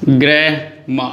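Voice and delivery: "ग्रेमा" slow, ending in open -ma; silent final R. Shown as a demonstration. Teacher models grammar, slow "ग्रेमा" ending without R. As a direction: draw out "ग्रेमा" with no R at the end. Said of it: "मतलब आर यहाँ silent है".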